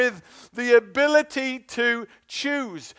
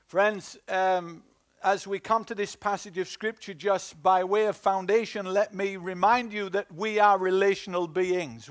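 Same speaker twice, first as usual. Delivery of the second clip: ordinary delivery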